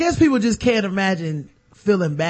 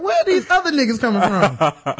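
laughing disbelief; 2 semitones higher than usual. Retold or imitated. Retold confusion at sudden crowd.

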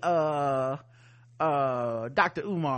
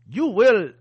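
stern master voice; 5 semitones higher, 9 dB louder than usual. Pretend. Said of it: "So are they gonna be like".

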